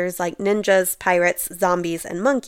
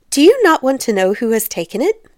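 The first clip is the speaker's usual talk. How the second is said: higher, insistent question with a sharp lift at the end; impatient wife; 5 semitones higher, 5 dB louder than usual. A narrator's reading. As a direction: press your husband for interest, impatiently. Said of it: "cried his wife impatiently".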